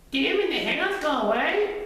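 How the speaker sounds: high-pitched little Duck; high, perky animal character. A narrator's reading.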